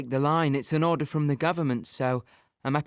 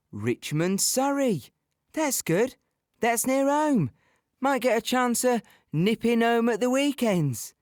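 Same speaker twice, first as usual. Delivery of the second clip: higher, eager voice recalling his hopes; hopeful inner thought; 6 semitones higher than usual. Retold or imitated. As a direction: play the young soldier pleased at his luck. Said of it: "rubbing my hands thinking".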